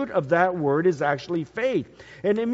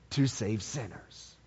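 hushed answer; 8 semitones lower, 9 dB quieter than usual. Real, not acted. Preacher answering his own question, hushed and low. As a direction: drop the voice to answer the why.

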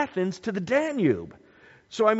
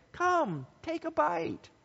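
tempting witch; 10 semitones higher, 7 dB quieter, slower than usual. Retold or imitated.

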